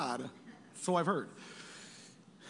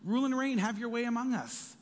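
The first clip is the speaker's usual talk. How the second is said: rising joyful welcome; 7 semitones higher than usual. Acted. Leaders welcoming the Messiah into the temple, joyous and rising. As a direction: build the welcome into glad praise.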